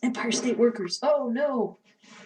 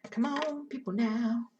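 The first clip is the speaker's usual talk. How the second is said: normal talking voice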